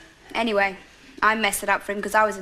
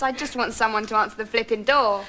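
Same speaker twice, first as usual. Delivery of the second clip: ordinary talk, nothing marked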